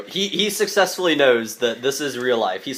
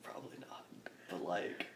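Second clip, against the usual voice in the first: barely audible whisper; whispered reply; 7 semitones lower, 23 dB quieter than usual. Acted. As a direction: whisper it back, unsure. Player echoes the line under breath.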